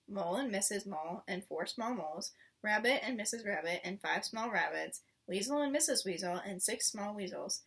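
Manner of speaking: ordinary delivery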